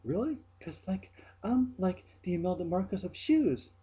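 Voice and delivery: squeaky airhead impression; mimicking coworker. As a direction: ham up her clueless bragging. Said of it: "she says".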